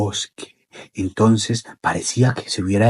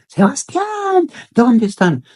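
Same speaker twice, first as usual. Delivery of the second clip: very high, drawn-out searching call; acted parents' far-carrying cry; shouting in search; 12 semitones higher, slower than usual. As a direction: call out for a lost child. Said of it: "comenzaron a gritar".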